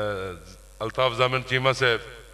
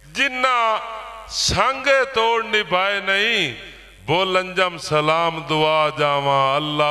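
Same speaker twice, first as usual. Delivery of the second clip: emphatic declamation, raised then settling at the close; poetry recital; 8 semitones higher, slower than usual. Acted. Poet repeats verse to a named guest, emphatic recital. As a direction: ring out each phrase with pauses between.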